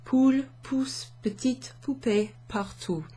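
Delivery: every P popped in "Poule pousse petite poupée partout"; stressed P sounds. Shown as a demonstration. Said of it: "lots of P sounds".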